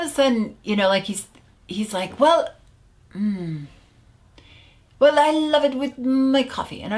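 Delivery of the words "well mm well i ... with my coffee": put-on high, hesitant voice with light French accent; French client. Client justifying smoking to the coach, mock French accent. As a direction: imitate a Frenchman searching for reasons, faintly accented. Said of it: "he was French".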